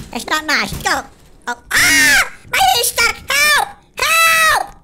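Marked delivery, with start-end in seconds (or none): 1.5-4.8 high loud cries for help; panicked shouts for help. Real, not acted. Gamer whose character is stuck, high loud cries for help. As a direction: scream for rescue.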